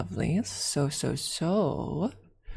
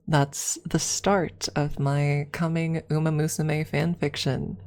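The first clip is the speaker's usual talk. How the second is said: normal talking voice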